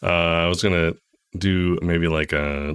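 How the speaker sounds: ordinary talk, nothing marked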